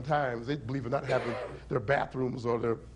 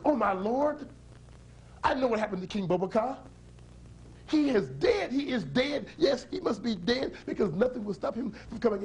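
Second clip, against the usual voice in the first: alarmed; 8 semitones higher than usual. Acted. Widow realizes king is dead.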